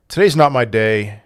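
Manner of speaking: usual speaking voice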